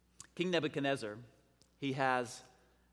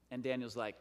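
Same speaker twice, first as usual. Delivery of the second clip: ordinary talk, nothing marked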